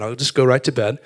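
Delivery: usual speaking voice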